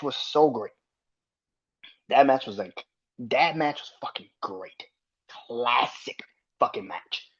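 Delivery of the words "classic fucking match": normal talking voice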